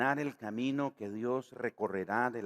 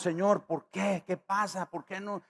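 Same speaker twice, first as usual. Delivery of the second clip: high, pleading complaint to God; whining believer; 8 semitones higher than usual. Retold or imitated. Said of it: "nos preguntamos".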